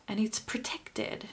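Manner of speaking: soft unvoiced whisper. Real, not acted. Reader told a secret, whispered unvoiced. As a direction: breathe it as a secret.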